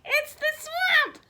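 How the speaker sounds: high loud frightened shout; team's scared outcry; panicked shout. Acted. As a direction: shriek the name in terror. Team panics at Coach Swamp.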